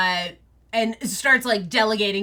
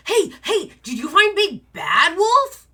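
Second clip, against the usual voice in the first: high, loud eager nephew; excited young wolf; 10 semitones higher, 7 dB louder than usual. Acted. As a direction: tug at him with a breathless question. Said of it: "is like".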